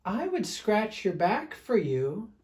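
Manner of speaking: a touch of a kind bear teasing; a voice from above. Acted. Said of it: "said a voice from above".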